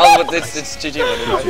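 exasperated squeal. Real, not acted. Losing the round, exasperated.

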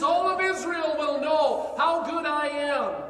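slow, loud declaration; booming proclamation. Acted. God declaring to Israel, slow loud proclamation. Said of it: "He says".

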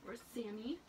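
ordinary delivery

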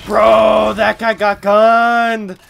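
loud, slow stretched-out yell; excited shout. Real, not acted. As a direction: shout it out in disbelief. Reacting to a kill.

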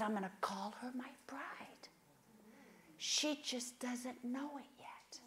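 hushed whisper of God's secret love; soft whispered promise. Retold or imitated. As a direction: breathe it like a tender secret. God's tender words, whispered.

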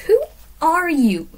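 high-pitched, restrained story scream; screamed question at half strength; mild scream. A narrator's reading. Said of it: "Zoe screamed".

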